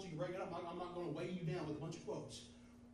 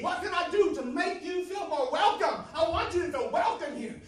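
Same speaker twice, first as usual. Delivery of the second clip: eager welcome; 12 semitones higher, 13 dB louder than usual. A narrator's reading. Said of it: "He stood watching, waiting".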